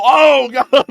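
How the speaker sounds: high, very loud shriek of dread; panicked Putin. Pretend. Putin hearing the books are cooked, shrieking in dread. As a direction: gasp it out in terror. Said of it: "He would go".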